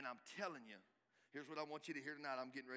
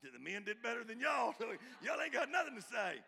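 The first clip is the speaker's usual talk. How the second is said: loud laughing call-out, pitched up; laughing tease; 7 semitones higher, 8 dB louder than usual. Real, not acted. Teasing the silent congregation.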